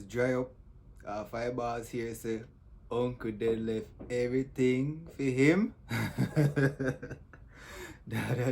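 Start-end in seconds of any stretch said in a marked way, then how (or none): none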